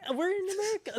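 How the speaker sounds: put-on a child whining back at his mom; high-pitched kid protest. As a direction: squeak it out like a defiant kid. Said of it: "And I'm like".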